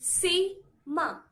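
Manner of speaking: "Sima" in two separated beats; syllable by syllable. Shown as a demonstration. Teacher asks students to observe carefully, syllable by syllable. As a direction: say "Sima" slowly, pausing between the syllables. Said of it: "Observe carefully".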